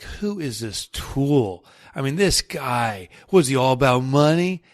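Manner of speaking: put-on tough New York guy accent; loud skeptical New York accent. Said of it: "that New York accent comes in".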